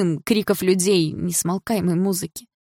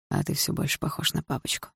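quiet taunt; 8 dB quieter than usual. A narrator's reading. Дима taunting rival about his father, quietly. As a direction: drop it softly. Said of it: "вдруг сказал Дима".

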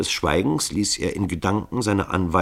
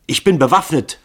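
higher and louder, menacing; threatening cry; 11 semitones higher, 10 dB louder than usual. A narrator's reading. Grunja threatening the killers, higher and louder. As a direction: warn them off with the gun. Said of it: "rief sie drohend".

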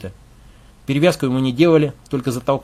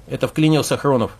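ordinary delivery

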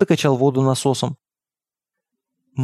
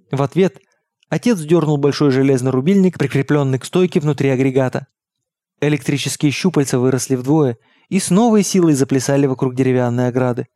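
usual speaking voice

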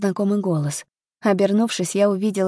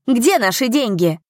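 louder, higher accusing question; crowd's raised-voice demand; demanding shout; 5 semitones higher, 3 dB louder than usual. A narrator's reading. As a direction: call out for what you're owed. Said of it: "кричали на него".

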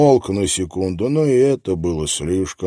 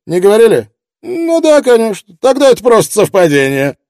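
brash reporter; 9 semitones higher, 8 dB louder than usual. Acted. Miller taunting the detective.

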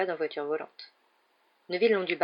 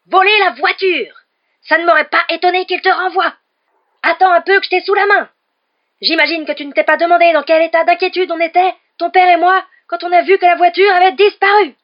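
furious shrieking mother, much higher and louder; mother roaring through a magic letter at her son; Mrs Weasley howler; 11 semitones higher, 16 dB louder than usual. A narrator's reading. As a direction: scream it like a letter bellowing at a son. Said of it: "Un rugissement féroce".

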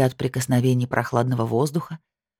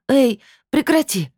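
higher, louder slow protest; breathless demand; 10 semitones higher, 4 dB louder, slower than usual. Acted. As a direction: demand it, still panting. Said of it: "Ещё тяжело дыша потребовала я".

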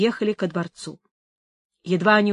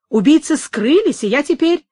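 louder, higher pleading protest; urgent protest; 5 semitones higher, 5 dB louder than usual. A narrator's reading. Captured Isidor claiming innocence to the adjutant, protesting urgently. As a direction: raise the voice to plead your case.

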